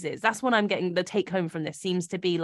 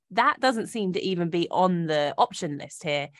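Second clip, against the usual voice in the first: normal talking voice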